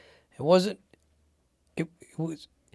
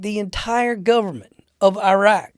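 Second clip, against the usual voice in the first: slow, loud, higher disbelieving question; retold incredulity; 6 semitones higher, 7 dB louder than usual. Marine checking his impossible orders with the boss, incredulous. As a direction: stretch out the mock disbelief.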